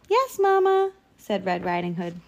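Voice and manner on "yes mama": put-on very high, louder child's voice; piping small child; obedient little girl. A narrator's reading. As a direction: answer like a dutiful little girl. Little girl answers her mother.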